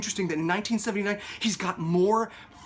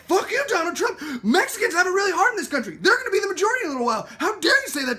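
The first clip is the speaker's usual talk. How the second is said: high, loud indignant crowd shout; mock outrage; 11 semitones higher, 5 dB louder than usual. Outraged public answers the villain candidate, mock indignant shouting. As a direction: fire back in hammed-up indignation.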